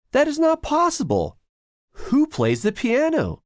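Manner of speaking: high, loud frightened cry; startled, fear rising in the voice; shivering. A narrator's reading. Timothy frightened by the piano, high shivering cry. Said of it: "shivers Timothy".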